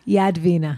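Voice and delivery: "Yadvina" with no R, open first vowel; name without R. Shown as a demonstration. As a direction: say "Yadvina" as Yahd-veena, dropping any R. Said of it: "There's no ah".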